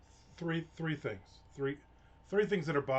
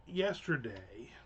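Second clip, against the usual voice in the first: whispered song hint; 3 semitones lower, 3 dB quieter, slower than usual. Shown as a demonstration. Nodding to the Beatles song for viewers, slow whisper. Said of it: "I know there's a song in that".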